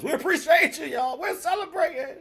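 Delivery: way up high in the voice, celebrating; excited squeal. Real, not acted. Host celebrating with subscribers, high excited squeal. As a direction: squeal with joy at the news.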